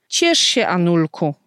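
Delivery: ordinary delivery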